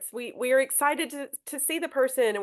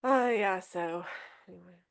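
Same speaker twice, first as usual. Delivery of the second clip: couldn't care less, fade to nothing; indifferent mumble; 3 semitones lower, 4 dB quieter than usual. Shown as a demonstration. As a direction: trail off, bored and fading. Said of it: "Is it one or two?".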